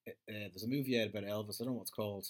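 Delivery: usual speaking voice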